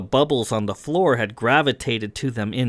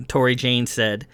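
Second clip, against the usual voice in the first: ordinary delivery, nothing marked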